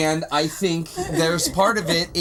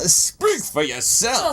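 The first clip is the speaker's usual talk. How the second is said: drawn-out, put-on retort; mock retort; 5 semitones higher, slower than usual. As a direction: stretch it out in a playful put-on voice. Playful mock retort to co-host.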